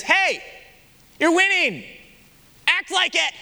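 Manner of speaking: rally the team like a coach; a light coach calling out to his players; coach's pep talk. Retold or imitated.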